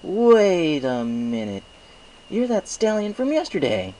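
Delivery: put-on high, slow young dragon voice, wary; suspicious dragon. Spike confronts the stallion from yesterday, suspicious and high. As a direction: narrow it down on the stranger. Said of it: "eyeing me with suspicion".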